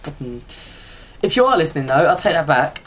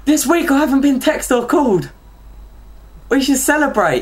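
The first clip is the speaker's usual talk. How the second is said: excited; 9 semitones higher than usual. Real, not acted. Celebrating no calls this week.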